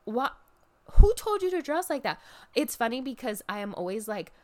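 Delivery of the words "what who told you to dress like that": much higher, loud disbelieving cry; mock outrage. Fan reacts to the wrestler's costume with loud high outrage. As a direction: yell it like you can't believe the outfit. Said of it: "I'm like".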